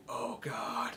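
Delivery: whispered under breath; mock whisper. Snake reacting to the kill, a whispered groan. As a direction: breathe it out in dismay. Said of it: "He's like".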